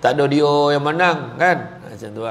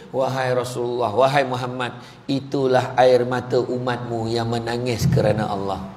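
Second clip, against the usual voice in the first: a hint of a grave angel; solemn angel voice; 7 semitones lower than usual. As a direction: speak as the angel Jibril, gravely. Jibril answers the Prophet.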